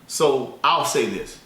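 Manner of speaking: slow, deliberate pace to explain. Shown as a demonstration. Teacher slowing down for students who can't follow, slow deliberate pace. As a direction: draw the words out for the listener. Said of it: "But let me slow it down".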